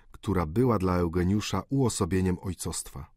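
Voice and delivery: usual speaking voice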